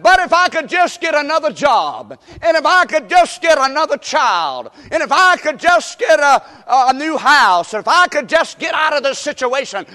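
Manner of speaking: high, loud wishing, piled up in repeats; mock pleading complaint. Restless believer wishing out of a hard situation, loudly pleading. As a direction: act out a restless believer begging for a way out. Voicing a discontented believer's wishes.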